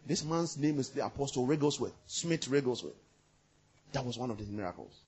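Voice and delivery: ordinary talk, nothing marked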